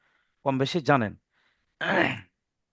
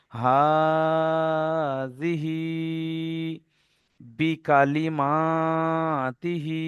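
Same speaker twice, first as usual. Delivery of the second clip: words chanted with long held vowels; slow recitation; 3 semitones higher, much slower than usual. Shown as a demonstration. Teacher reciting the line for learners, slow chant. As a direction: recite each word, stretching the হি. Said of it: "হা খাড়া জের হিটা টান হচ্ছে".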